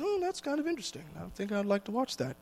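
hushed, curious musing to oneself; mock intrigued. Acted temptation, contrasted with recoil.

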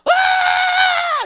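sudden loud high-pitched scream; high, sustained mock scream at full volume; imitated scream. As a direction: let out a long piercing shriek, mimicking the woman. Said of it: "someone's screaming".

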